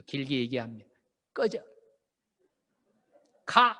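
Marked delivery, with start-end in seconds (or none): 1.3-3.8 curt command. Shown as a demonstration. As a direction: snap them off short like war talk. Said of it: "이게 다 전쟁 용어들이에요".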